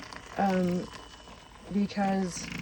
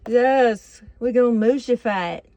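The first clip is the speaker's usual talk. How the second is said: loud, higher-pitched put-on Southern twang; playful Southern accent; 4 semitones higher, 9 dB louder than usual. Cook jokes about her silly word, in a Southern accent. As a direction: belt it out in a mock Southern accent. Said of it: "I go Southern when I say silly words".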